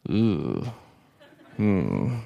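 a dentist's grim little verdict; disapproving dentist. Retold or imitated. Dentist examining teeth, disapproving.

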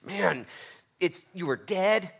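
high, loud, slow words of grace; passionate preaching. Acted. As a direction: pour it out at full force. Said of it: "He says".

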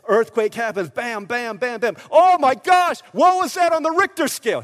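very loud, high, hammed-up awe; mock amazement. Jailer gawping at an earthquake, mock amazement. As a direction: ham up the stunned reaction.

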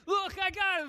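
high, loud triumphant shout; excited calling out. Acted. Andrew shows Jesus the boy he found, excited shout. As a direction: call it out proudly over a crowd.

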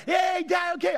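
high-pitched mock call to the stands; calling out to dad. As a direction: imitate a son calling up to his dad. Son calling up to loud dad.

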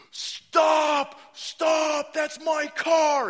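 high, loud yelling after a thief; frantic stop, slowing on that's my car; desperate shout. Retold or imitated. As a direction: scream at the car thief as he runs. Owner chasing his stolen car.

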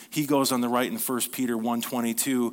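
ordinary talk, nothing marked

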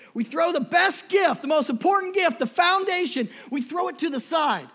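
high, loud cry about the gift; preaching shout. Real, not acted. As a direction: throw it out to the room full force. Preaching at full force.

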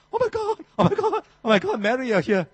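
very high, fast excited squealing. Pretend. Preacher voicing Elizabeth greeting Mary, high excited squeal. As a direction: shriek with joy at the guest's arrival. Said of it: "So she'd be like that".

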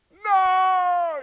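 put-on high, loud kid's cry; child crying no to mom; mock wail. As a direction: cry out in dread. Child wails.

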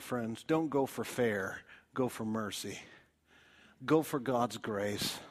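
normal talking voice

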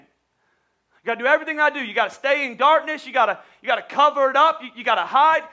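loud, high, insistent tempter; pushy tempter voice. Retold or imitated. Satan ordering the sinner to hide, loud and pushy. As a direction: press him like the devil would.

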